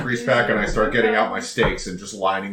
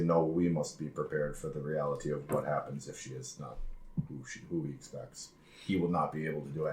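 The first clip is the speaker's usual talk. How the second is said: low, hushed warning; soft, low, serious in-character counsel; grave aside; 6 semitones lower, 12 dB quieter than usual. Acted. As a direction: confide it quietly, out of his hearing.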